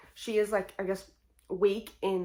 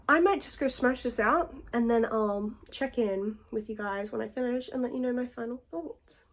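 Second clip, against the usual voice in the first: normal talking voice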